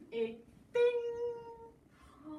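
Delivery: high ping sound effect; a bright chime; magic ping. Acted. Magic sound turns girl gold.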